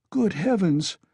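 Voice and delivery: high, startled cry of shock. A narrator's reading. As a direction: gasp it out in horror. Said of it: "cried Pulcheria Alexandrovna".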